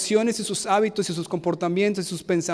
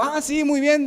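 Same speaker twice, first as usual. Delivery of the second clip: lightly voiced eager little boy, higher; a hint of a cheerful child; 8 semitones higher than usual. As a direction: chirp it like a six-year-old. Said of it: "El niño dijo".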